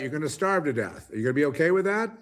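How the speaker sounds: usual speaking voice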